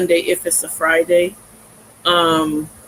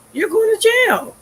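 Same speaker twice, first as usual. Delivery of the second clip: mock cop voice; 14 semitones higher than usual.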